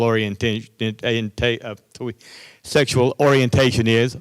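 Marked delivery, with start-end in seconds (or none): none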